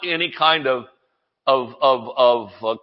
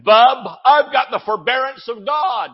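defiant declaration; 6 semitones higher than usual. Pretend. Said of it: "we need to say".

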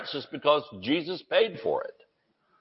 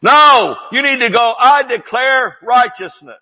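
high, louder insistent call; emphatic preaching; 7 semitones higher, 6 dB louder than usual. Real, not acted. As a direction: drive it home to the crowd. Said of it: "I know I'm screaming".

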